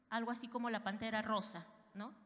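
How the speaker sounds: ordinary talk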